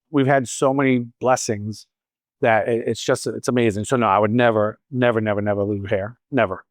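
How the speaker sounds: normal talking voice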